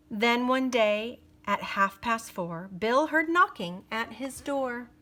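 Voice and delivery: put-on lively read-aloud for kids; story narration. A narrator's reading. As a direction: read it like a bedtime picture book. Reading a rhyming picture book.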